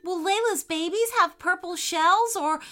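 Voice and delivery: high, curious little fish. A narrator's reading. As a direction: ask eagerly like a tiny fish. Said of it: "asked a little fish".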